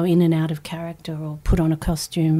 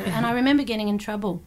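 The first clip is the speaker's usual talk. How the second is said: ordinary talk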